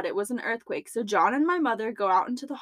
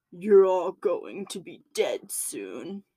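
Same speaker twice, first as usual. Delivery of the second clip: low, slow demonic voice; a slow, low demon's threat; satanic voice; 3 semitones lower, slower than usual. Retold or imitated. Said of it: "Followed by".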